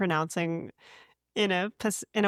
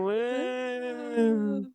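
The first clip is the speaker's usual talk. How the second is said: reserved woo; 5 semitones higher than usual. Real, not acted. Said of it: "Reserved woo".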